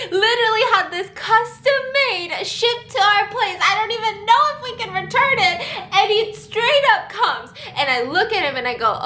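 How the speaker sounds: ordinary talk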